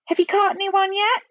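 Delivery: high, severe questioning; icy. A narrator's reading. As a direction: demand an answer as a strict nanny. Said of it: "in an icy voice".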